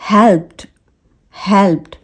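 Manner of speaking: हेल्प्ड as "helpt"; one-syllable हेल्प्ड; tiny t closing हेल्प्ड; soft T ending. Shown as a demonstration. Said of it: "ये वाला जो टी का जो sound".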